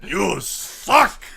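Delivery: loud, high mock monster verdict; mean zombie judge. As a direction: echo the brutish judge, word by word.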